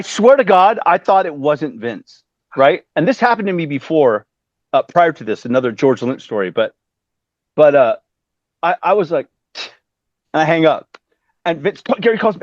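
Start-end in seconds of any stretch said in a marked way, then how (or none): none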